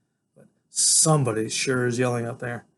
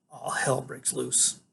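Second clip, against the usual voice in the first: ordinary delivery, nothing marked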